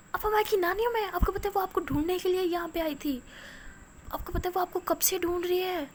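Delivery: high, loud pleading; girl begging the silent grandmother for an answer; desperate plea. Acted. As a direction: beg her to speak up, pitch climbing high.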